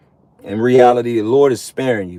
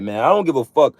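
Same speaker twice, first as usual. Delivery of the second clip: usual speaking voice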